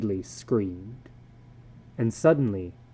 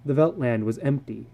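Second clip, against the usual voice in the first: ordinary delivery, nothing marked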